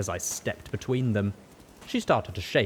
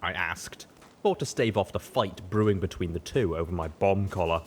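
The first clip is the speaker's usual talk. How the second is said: ordinary delivery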